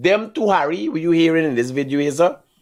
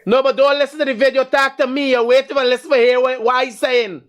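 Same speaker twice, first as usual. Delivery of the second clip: exasperated scolding; 6 semitones higher than usual. Real, not acted. Host losing patience with a caller who keeps listening to the radio, exasperated. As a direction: press him hard to turn the video off.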